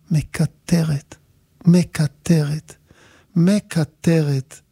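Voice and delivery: very slow, each word stretched apart; drawn-out repetition. Retold or imitated. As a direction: stretch it out to show endless complaining. Dragging out a child's endless whining. (לאט, במריחה ובהדגשה)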